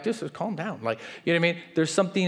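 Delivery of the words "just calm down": soft, unbothered reply; mildly soothing; calm dismissal. Retold or imitated. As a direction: say it quietly like someone unruffled. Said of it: "they're like".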